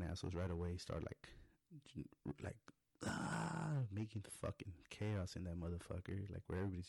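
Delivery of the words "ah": high drawn-out "aah"; kids' mock yell. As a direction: imitate rowdy kids yelling, high. Imitating rowdy kids running in yelling.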